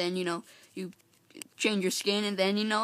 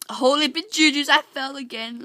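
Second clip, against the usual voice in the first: louder, higher startled outburst; startled exclamation; 6 semitones higher, 8 dB louder than usual. Real, not acted. Gamer who just fell in the game, startled exclamation. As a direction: blurt it out in alarm, pitched up.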